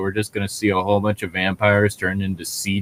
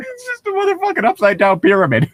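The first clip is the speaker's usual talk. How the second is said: laughing; 4 dB louder, faster than usual. Real, not acted. Laughing at Dorito shape.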